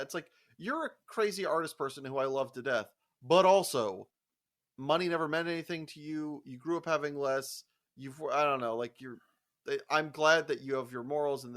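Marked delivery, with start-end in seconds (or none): none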